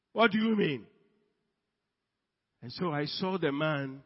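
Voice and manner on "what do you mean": louder, higher offended protest; indignant outburst. Acted. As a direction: act affronted and raise your voice. Offended big man repeats himself louder.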